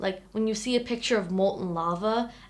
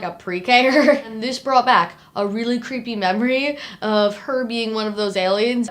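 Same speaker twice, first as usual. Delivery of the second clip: usual speaking voice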